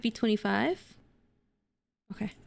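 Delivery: ordinary talk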